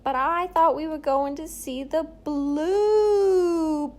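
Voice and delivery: put-on old-timey New Jersey movie voice, high and loud; hammed-up 1950s Jersey whine. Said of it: "nineteen fifties Jersey voice going".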